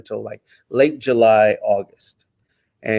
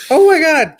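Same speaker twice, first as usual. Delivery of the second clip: high, loud cry of disbelief; overwhelmed; 8 dB louder than usual. Retold or imitated. Prisoner hearing he is going home, overwhelmed. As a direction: re-enact being overcome, pitch leaping up. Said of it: "Try not to cry".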